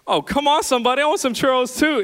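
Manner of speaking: mock pleading. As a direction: whine it like a kid wanting a treat. Begging wife for churros too.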